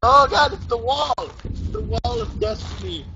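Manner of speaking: mock-epic cry at the wall; dramatic exclamation. Real, not acted. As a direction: announce it grandly.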